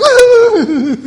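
high, loud mock-cowering laugh; cowardly lion's nervous laugh. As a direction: burst out like the frightened cowardly lion. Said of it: "cowardly lion goes".

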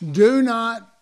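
loud, high pronouncement of the law; stern acted command. Quoting the law's prohibition.

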